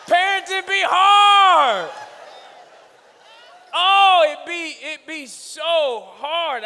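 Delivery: high, loud, slow wail; loud comic lament. Real, not acted. Preacher moans to the crowd about parenting, loud and slow. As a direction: wail it out, half-joking.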